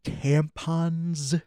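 slow, through clenched teeth; gritted teeth. Acted. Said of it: "talking through his gritted teeth".

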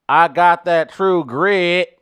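slow, loud, drawn-out movie cowboy; True Grit cowboy. Acted. Comic asked to sound like the Western, slow loud cowboy impression. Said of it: "How would you have sounded if you were in that movie".

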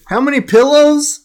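high, loud disbelieving echo; surprised. Real, not acted. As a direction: act shocked by the question. Incredulous echo of question.

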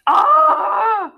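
long, loud, high animal scream; high-pitched sustained ape shriek; baboon scream. A narrator's reading. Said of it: "Khufu screamed".

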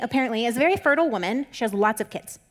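normal talking voice

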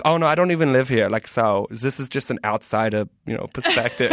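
put-on comic German accent; light-hearted German-accented character voice. Shown as a demonstration. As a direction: play a German guy giving his outsider's view. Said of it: "in that German accent".

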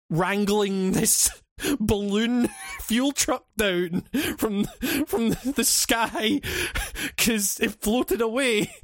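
slow, words broken up by giggles; talking through laughter. Real, not acted. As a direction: struggle to get it out while laughing. Cracking up at the absurd story.

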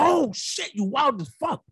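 high startled "oh shit"; mock surprise. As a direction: jump up on the oh in surprise. Reacting to stripper, acted quote.

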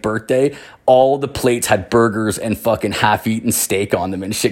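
ordinary talk, nothing marked

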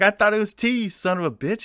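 high-pitched, loud comic outrage; retold angry protest. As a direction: yell back at your buddy in mock fury. Retold quarrel over the tea.

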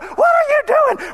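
shocked shout. Retold or imitated. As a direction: shout in alarm at the bonfire. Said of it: "she comes out".

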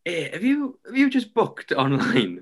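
lightly voiced incredulous caller, pitched high; a hint of an imitated Scouser; Scouse caller. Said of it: "This Scouser going".

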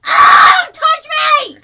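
screeching high, very loud cry; mock ecstatic cry. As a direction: shriek it in hammy ecstasy. Mock ecstatic cry when called over.

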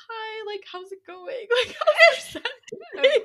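high, nervous phone greeting breaking into a laugh; overly bright, nervous hello; awkward high greeting. Retold or imitated. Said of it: "I'm like".

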